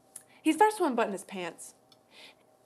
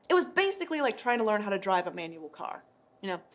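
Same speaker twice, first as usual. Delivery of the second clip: ordinary delivery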